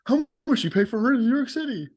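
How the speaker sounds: high, put-on interviewer voice; street interviewer. Street interviewer stopping New Yorkers about rent, high and eager. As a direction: pipe up like a nosy video host. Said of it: "you just have a guy walking around".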